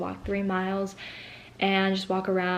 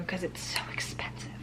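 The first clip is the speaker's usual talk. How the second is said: whispered aside; 10 dB quieter than usual. Real, not acted. Muttered aside.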